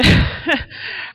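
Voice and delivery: breathless laugh. Real, not acted. Laughing at the wild drive.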